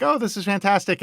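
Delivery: high-pitched acted delight; delighted mother.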